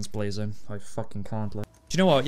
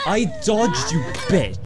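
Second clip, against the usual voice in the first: loud, high cry of protest; real gamer outrage, starting high; indignant outburst; 6 semitones higher, 5 dB louder than usual. Real, not acted. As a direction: yell at the game for cheating you. Hit despite dodging in game.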